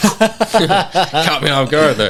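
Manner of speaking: slow, laughing through the words. Real, not acted. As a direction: laugh it out, caught off guard. Laughing after being surprised.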